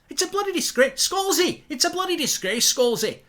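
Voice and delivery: high hammed-up outrage; mock-furious outburst at the co-host; outraged. Real, not acted. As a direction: rant it at Scalzi, way up high. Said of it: "I'm outraged".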